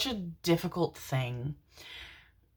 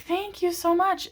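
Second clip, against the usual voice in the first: sweet thanks; 13 semitones higher than usual. Retold or imitated. Quoting herself to gentleman.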